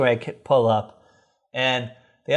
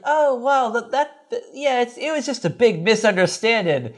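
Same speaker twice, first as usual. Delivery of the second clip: higher, breezy mom voice; Carol voiced; 8 semitones higher than usual. Carol excusing the man who jailed them, higher breezy voice. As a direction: brush it off like a cheery mother. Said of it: "Carol's like".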